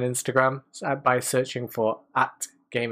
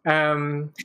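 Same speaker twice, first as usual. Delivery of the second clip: usual speaking voice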